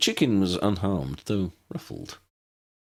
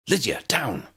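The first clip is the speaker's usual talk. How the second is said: cried out; 6 semitones higher than usual. A narrator's reading. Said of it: "cried Ambrose".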